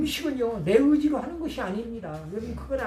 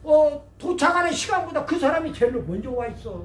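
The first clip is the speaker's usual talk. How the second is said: raised, pressing sermon storytelling; impassioned preaching; 6 semitones higher, 4 dB louder than usual. Real, not acted. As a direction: build it up with emphatic high-pitched bursts.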